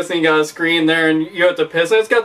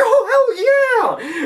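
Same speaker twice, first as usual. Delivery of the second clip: much higher, slow stretched hell yeah with a laugh; thrilled; much slower than usual. Pretend. Drunk guy thrilled by the urinal game, much higher slow whoop. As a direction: drag it out in giddy delight.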